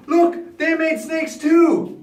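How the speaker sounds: high, loud mock amazement; amazed crowd. Courtiers see the magicians' snakes, high loud amazement. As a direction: marvel loudly, drawing the words out. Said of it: "the people all got confused in their minds".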